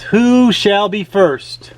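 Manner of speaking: ordinary delivery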